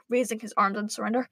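ordinary delivery, nothing marked

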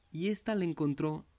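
usual speaking voice

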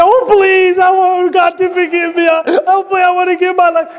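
high, pleading plea to God; pleading convert. Retold or imitated. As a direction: beg for forgiveness with a lifted voice. Pleading converts voiced in sermon.

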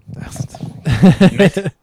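laughing hard through the words; drawn-out laughing, barely getting words out. Real, not acted. Friend laughing at a game name, laughing hard.

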